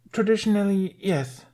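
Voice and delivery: high muffled voice from inside a huddle; muffled reply. A narrator's reading. Fishlegs curled up explaining knighthood, muffled. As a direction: speak as if head is tucked away. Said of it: "came Fishleg's muffled reply".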